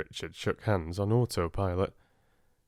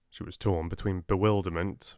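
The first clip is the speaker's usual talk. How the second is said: ordinary delivery, nothing marked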